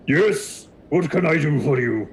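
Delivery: high, put-on minotaur voice; minotaur character. Minotaur looking down at his visitor, put-on creature voice.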